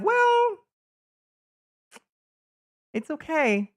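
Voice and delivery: leaps way up on "Well", then settles; squeaky falsetto for a so-so verdict; high mock voice. Voicing a lukewarm tier label.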